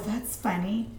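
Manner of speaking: ordinary delivery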